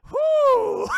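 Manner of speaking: high, loud cheer; excited whoop. Real, not acted. Streamer cheering at the funny scene. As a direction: whoop with delight.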